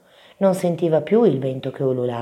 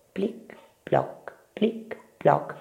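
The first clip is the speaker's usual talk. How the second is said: soft, whispered drip sounds; tiny spaced water drops; imitated dripping; 5 semitones higher, 5 dB quieter, much slower than usual. A narrator's reading. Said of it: "cosa sarà questo rumore?". (gocce sussurrate)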